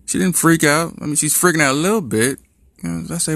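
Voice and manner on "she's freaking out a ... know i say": ordinary delivery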